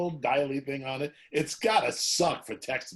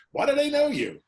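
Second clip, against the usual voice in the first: put-on higher, louder voice of a puzzled small boy; little kid's question; 13 semitones higher, 6 dB louder than usual. Young son asking his dad at the mall, childlike. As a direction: ask it like a confused little kid.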